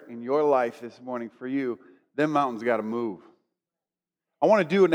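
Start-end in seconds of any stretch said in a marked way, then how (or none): 2.1-3.2 low Southern twang, lightly done. Retold or imitated. Preacher declaring over the congregation's lives, Southern twang. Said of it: "drawn-out Southern twang".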